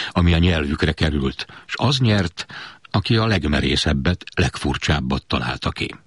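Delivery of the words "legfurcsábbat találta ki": usual speaking voice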